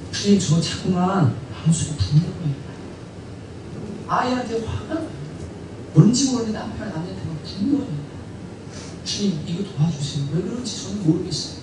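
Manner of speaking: soft, low confiding plea; troubled prayer. Acted. Believer confesses anger at child and husband to the Lord, hushed. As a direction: confide the problem haltingly and quietly.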